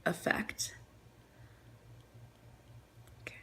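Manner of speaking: soft whisper while concentrating; whispered aside. Real, not acted. Whispering to viewers while painting a nail. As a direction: breathe the word out under your breath.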